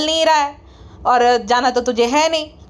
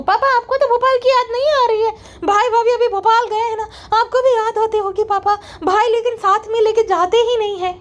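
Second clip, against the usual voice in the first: higher, sugary "friends" vlogger impression; mocking her sister-in-law's vlog voice, pitched higher; 7 semitones higher than usual. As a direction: ham up a fake-sweet influencer addressing fans.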